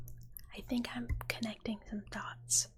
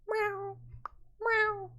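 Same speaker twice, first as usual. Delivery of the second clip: high, loud meow; high kitty meow, playfully put on; cat meow; 11 semitones higher, 6 dB louder than usual. Acts out cat brain rot video.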